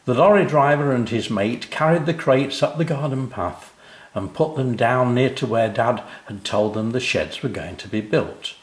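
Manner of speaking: ordinary delivery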